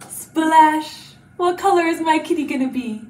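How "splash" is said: loud, full-voiced splash. Teacher dunking a kitty in paint for children, loudly voiced.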